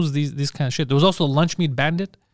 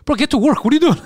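high-pitched, louder retold telling-off; mock scolding; 11 semitones higher, 4 dB louder than usual. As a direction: scold a slacking coworker, half laughing. Retold scolding for not working.

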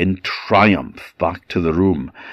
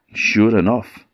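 ordinary delivery, nothing marked

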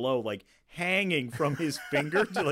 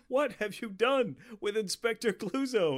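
mimicking Kato; 10 semitones higher than usual. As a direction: imitate the film line, pitched way up. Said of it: "And he's just like".

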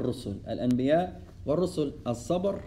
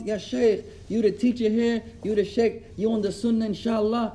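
higher-pitched mild mock yell; retold brother yelling at the sheikh, lightly acted; 6 semitones higher than usual. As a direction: imitate a rude brother confronting the sheikh. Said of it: "screaming, yelling".

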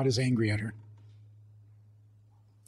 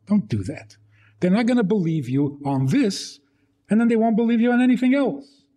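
ordinary talk

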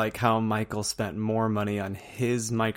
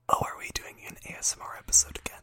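ASMR whisper; 6 dB quieter, faster than usual. Pretend. Joining the ASMR whisper bit.

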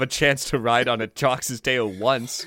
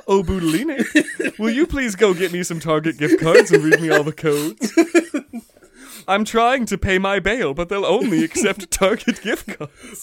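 higher, louder laughing scam pitch; mock scammer; 6 semitones higher, 6 dB louder than usual. Fox scamming Budulinik, laughing high plea. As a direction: plead like a phone scammer.

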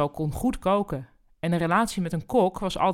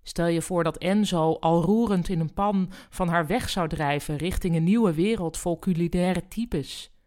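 usual speaking voice

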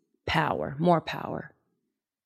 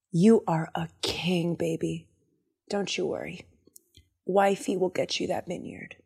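low, hushed, soothing coo; calm a whining husband, very softly; reassuring wife; 3 semitones lower, 9 dB quieter than usual. Retold or imitated. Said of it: "And she goes".

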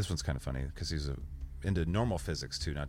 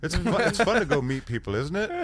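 sarcastic squeal; 12 semitones higher, 8 dB louder than usual. Acted. Sarcastic squeal about meeting people.

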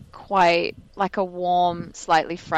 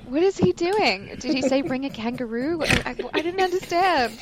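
high, puzzled, lightly voiced crew member; confused crew; 7 semitones higher than usual. Film crew baffled by the actor's accent, puzzled. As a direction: play a bewildered set worker who can't follow. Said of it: "And everyone's like".

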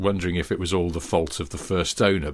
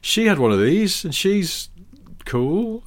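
mock sales pitch; 11 semitones higher, 3 dB louder than usual. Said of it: "I'll go".

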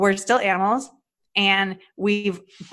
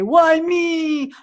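high, loud mock whine of "Why me?"; 10 semitones higher, 6 dB louder than usual. Comedian mocking the self-pity she avoided, hammed-up whine. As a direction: whine it like a self-pitying victim. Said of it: "I didn't whine and say".

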